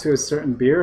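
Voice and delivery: ordinary talk, nothing marked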